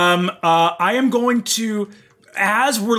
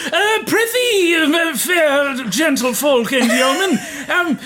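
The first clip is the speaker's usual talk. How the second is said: hammy stage actor; 10 semitones higher than usual. Acted. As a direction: overact like an Elizabethan stage player. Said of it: "I'm just gonna go".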